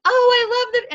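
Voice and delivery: high, gushing customer; excited customer voice. Acted. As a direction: play the delighted customer. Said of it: "and Sherry says".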